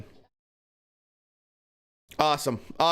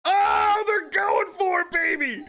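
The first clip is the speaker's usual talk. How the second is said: excited shout; 9 semitones higher than usual. Real, not acted. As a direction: cheer them on, thrilled. Fight watcher cheering exchange.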